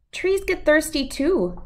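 a hint of a wise grandma; Nana's voice. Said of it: "his Nana told him".

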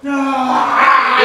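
high loud shout of alarm; panicked shout. Retold or imitated. Partner shouting as the trap closes. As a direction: yell as if caught again. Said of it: "He's like".

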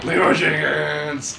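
slow loud drawn-out yell; battle cry. Acted. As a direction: charge in yelling. Famous battle cry quote.

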